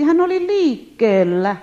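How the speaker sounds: slow, high and loud emphasis on hän; preached proclamation. Acted. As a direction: proclaim that God was moving.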